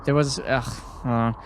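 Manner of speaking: ordinary talk, nothing marked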